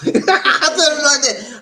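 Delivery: high, loud, drawn-out laugh-talk. Real, not acted. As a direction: say it through a big laugh. Cracks up at his own comeback.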